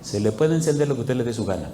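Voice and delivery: low, soft, unruffled; soothing, understated retort; calm reply. Retold or imitated. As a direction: answer the agitated man quietly, voice dropped. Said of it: "Y yo le decía, Tranquilo".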